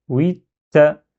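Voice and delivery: "oui, ta" said "wit...ta"; shadda. Shown as a demonstration. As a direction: pause on the doubled t before releasing "ta". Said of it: "c'est ça le shadda".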